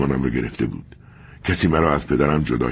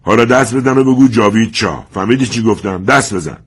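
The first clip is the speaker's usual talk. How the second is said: loud, higher bullying yell; angry shout; 6 semitones higher, 6 dB louder than usual. Acted. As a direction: order him to clap, shouting. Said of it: "فریاد زد".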